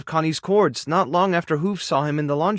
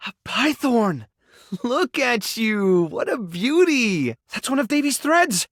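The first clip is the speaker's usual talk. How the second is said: high, drawn-out admiring exclamations; delighted cooing; 6 semitones higher, slower than usual. Acted. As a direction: gush over an adorable find. Delighted discovery of small creature.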